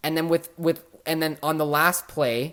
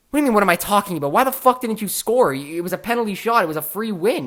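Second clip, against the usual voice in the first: fast, higher, louder exasperated rant; retold heated rant; 7 semitones higher, 6 dB louder, faster than usual. Arguing with Joe about the missed penalty shot, fast angry rant. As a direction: rattle it off in outraged disbelief.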